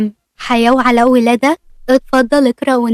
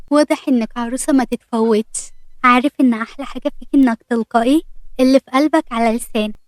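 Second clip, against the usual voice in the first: ordinary delivery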